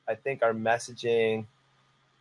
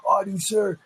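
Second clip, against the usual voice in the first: imitating keen kids, voice pitched up high; eager kids; 7 semitones higher than usual. Kids volunteering for summer camp work, high eager imitation. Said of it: "All the kids were like".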